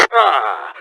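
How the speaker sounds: usual speaking voice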